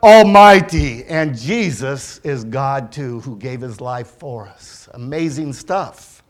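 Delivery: ordinary talk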